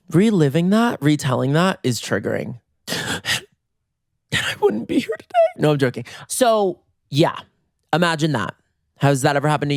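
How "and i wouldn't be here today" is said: squeaky, high mock sobbing; fake breakdown. Storyteller joking that retelling is triggering, high fake tearful squeak. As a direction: pretend to choke up, voice way up high.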